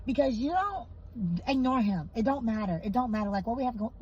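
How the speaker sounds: normal talking voice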